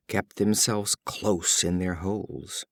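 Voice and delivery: ordinary delivery, nothing marked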